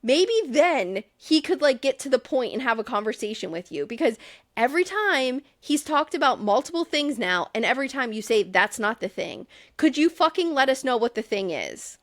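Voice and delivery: exasperated demand, pitch jumping up; annoyed. Real, not acted. Host scolding Amanda for not explaining, exasperated. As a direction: challenge her with mounting impatience. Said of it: "I'm so annoyed with her".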